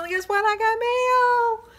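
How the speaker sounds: high, loud, drawn-out thrill; excited. Real, not acted. Announces the mail to viewers, thrilled and loud.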